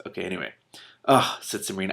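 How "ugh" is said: louder groan of dismay; dismayed ugh. Acted. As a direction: groan at the problem. Princess dismayed.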